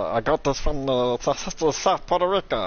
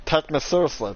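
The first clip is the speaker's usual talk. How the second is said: normal talking voice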